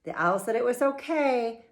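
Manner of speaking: ordinary delivery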